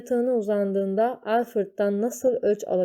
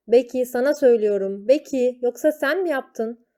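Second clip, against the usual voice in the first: higher, louder stern teacher demand; stern interrogation; 5 semitones higher, 5 dB louder than usual. Acted. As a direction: corner the girl with a raised voice. Teacher grills Becky.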